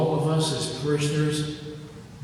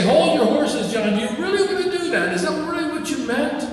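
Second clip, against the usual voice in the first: higher, fast pleading aside; playful higher plea to John; mock plea to John; 12 semitones higher, 4 dB louder, faster than usual. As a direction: tug at John's sleeve, hurried and high. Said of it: "I almost want to say".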